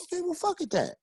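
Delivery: high, offhand mock agreement; high casual go-along; imitating himself. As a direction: shrug it off in a put-on voice. Agreeing to meet Greg.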